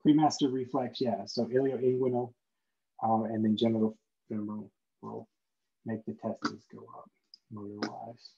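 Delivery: ordinary talk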